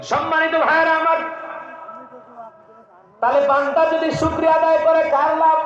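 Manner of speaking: a preacher scolding the proud, building to a shout; fiery preaching. Acted. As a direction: thunder against pride. Preaching at full force.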